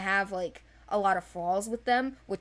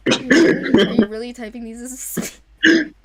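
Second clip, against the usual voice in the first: much louder, teasing incredulity; mock disbelief; 3 semitones higher, 12 dB louder than usual. Teasing her co-host in loud mock disbelief. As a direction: pretend to be amazed.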